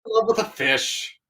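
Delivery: high gurgly cartoon sailor; Seaspray voice. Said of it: "Yeah, the gurgly guy".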